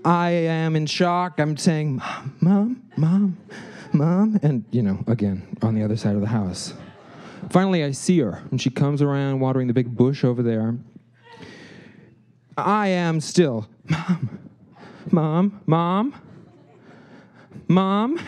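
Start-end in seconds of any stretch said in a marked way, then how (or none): 2.0-4.4 mild mock calls for mom, higher; retold cries for mom; calling out. Calling mom with rod in foot. 13.9-18.2 higher, rising calls for mom; repeated mock calls for mom; calling out.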